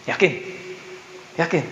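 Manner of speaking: ordinary talk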